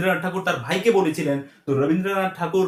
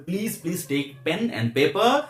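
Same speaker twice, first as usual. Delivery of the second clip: slow earnest plea; much slower than usual. Real, not acted. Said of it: "pleading with viewers to act".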